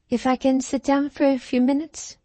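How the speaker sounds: a shaken little lady with a light foreign lilt; pretty foreign accent. A narrator's reading. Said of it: "she said in her pretty foreign accent".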